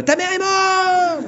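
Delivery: very high, slow dramatic cry; hammed-up dramatic announcement; mock horror shout. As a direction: announce it like a horror movie scare. Imagined horror interruption.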